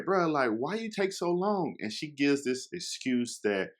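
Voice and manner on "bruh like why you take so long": exasperated. Retold or imitated. Pressing her for number.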